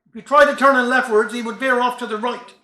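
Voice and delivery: usual speaking voice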